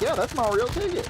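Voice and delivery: very high, loud put-on voice; mimicked high-pitched comeback; mock retort. Voices the man's reply to trolls.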